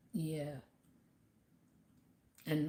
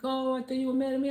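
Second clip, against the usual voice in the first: higher, fast and relieved; relieved worker; 8 semitones higher, 6 dB louder, faster than usual. Retold or imitated. The worker telling her he thought she was mad, high and fast. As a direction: rush it out, relieved it wasn't him. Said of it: "He's like".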